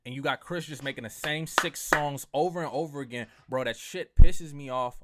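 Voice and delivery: loud, heated yell; angry outburst. Real, not acted. Host yelling his frustration at [name], a real angry outburst. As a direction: blow up at the repetitive songs.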